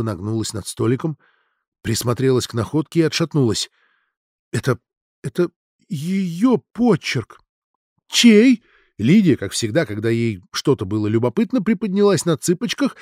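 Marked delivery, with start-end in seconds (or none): 4.5-7.4 slow, halting, higher words; falter, then force it out in shock; shaken recognition. A narrator's reading. Simonova recognizes the handwriting on the note, shaken. 8.1-8.6 high, loud, curious question; eager question. A narrator's reading. Lidia strains to learn whose handwriting, eager.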